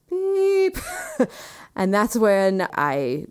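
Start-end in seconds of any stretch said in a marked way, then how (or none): 0.1-0.8 high, sustained beep; imitated monitor beep. Joking about her heart stopping, mock monitor beep. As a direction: mimic a long electronic tone.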